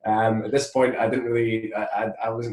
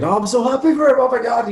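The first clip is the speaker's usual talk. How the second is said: much higher and louder, squealing with joy; gushing delight; 13 semitones higher, 8 dB louder than usual. Retold or imitated. Friend seeing his wedding post, gushing excitedly. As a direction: gush like a thrilled fan. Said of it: "I'm just thinking".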